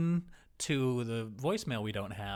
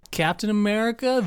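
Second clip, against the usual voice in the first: ordinary talk, nothing marked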